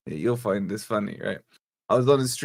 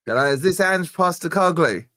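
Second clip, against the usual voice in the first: put-on Australian accent, pitched up a touch; hamming up an Aussie TV producer's greeting; mock Aussie caller; 6 semitones higher, 4 dB louder than usual. Retelling an Australian caller's phone greeting, mock Aussie accent.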